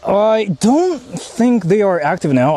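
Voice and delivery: ordinary delivery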